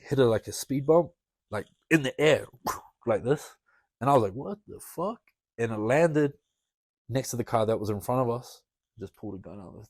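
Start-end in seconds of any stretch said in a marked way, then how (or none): 4.3-5.2 high, hushed disbelief; retold shock. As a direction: re-enact stunned confusion, softly. Retold shocked reaction to speeding car.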